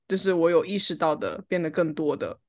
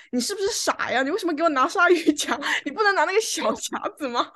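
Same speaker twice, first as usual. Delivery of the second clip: a higher-pitched, exasperated retold snap; mock angry scolding; 8 semitones higher than usual. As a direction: imitate him losing his temper over a hair clip, not fully in earnest. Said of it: "他突然间就跟我发脾气".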